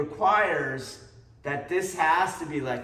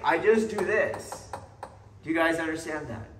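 usual speaking voice

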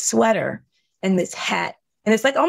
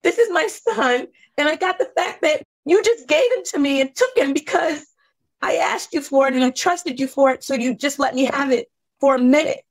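much higher, straining voice; anguished disbelief; 7 semitones higher than usual. Retold or imitated. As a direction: ask it as if the ground fell away, voice climbing high. Anguished reliving.

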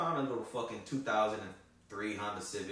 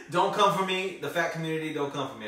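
louder, higher joking plea; half-laughing please don't come at me; mock plea; 5 semitones higher, 8 dB louder than usual. Real, not acted. Pleading with fat community.

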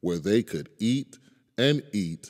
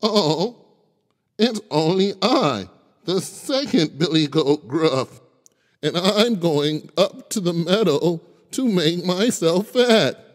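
high, louder goat voice; middle goat voice; 10 semitones higher, 5 dB louder than usual. Acted. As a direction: speak up like a medium-sized goat. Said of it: "his voice was not so small".

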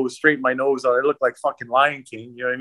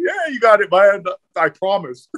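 mock innocent promise; 6 semitones higher than usual. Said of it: "I'm like".

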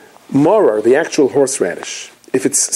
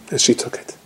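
ordinary delivery

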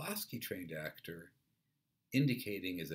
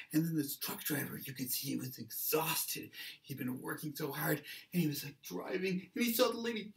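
high-pitched, sympathetic eyewitness telling; second witness; 10 semitones higher than usual. Acted. Second witness explains tired driver to police, high and earnest. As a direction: plead the exhausted driver's case. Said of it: "Then somebody else says".

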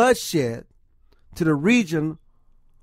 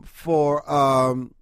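ordinary delivery

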